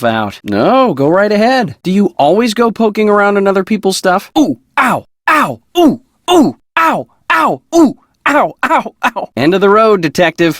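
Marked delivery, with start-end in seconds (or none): 4.4-9.3 high, sharp cries of ow and ooh at each blow; pained yelps. Acted. Being struck, yelping ow and ooh in pain. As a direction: yelp as if something keeps hitting you. Hit repeatedly, crying out in pain.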